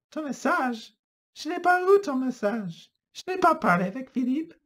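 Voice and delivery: Rachel, high and bewildered; protest, baffled and high; high confused woman. Acted. Rachel protesting to Antoine, high and confused. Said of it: "Elle lui répond".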